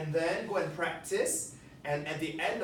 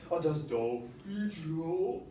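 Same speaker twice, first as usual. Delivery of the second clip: drawn-out slow puppet voice; slower than usual. Shown as a demonstration. Teacher demonstrating slow puppet speech. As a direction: stretch every word out. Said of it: "And then we have speed".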